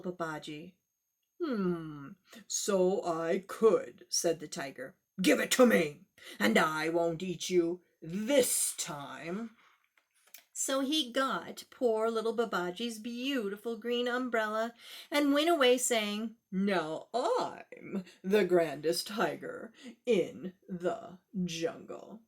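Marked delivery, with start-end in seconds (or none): 1.4-9.6 tiger voice. Acted. Tiger accepts, voiced lower. 16.5-22.2 slow, lower proud tiger; tiger boast. Acted. Tiger boasting after taking the umbrella, slow and low.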